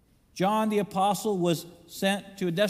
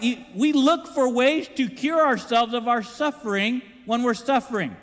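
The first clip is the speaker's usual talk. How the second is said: ordinary talk, nothing marked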